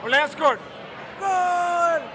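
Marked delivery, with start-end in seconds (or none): 1.2-2.0 high, drawn-out cheer of Goal. Shown as a demonstration. Fan cheering a goal for the interviewer, high goal cheer. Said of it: "give us one little cheer".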